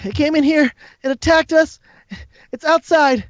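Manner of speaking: high, strained character voice; pleading wounded figure. Acted. Game master voices a figure.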